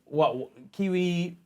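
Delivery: usual speaking voice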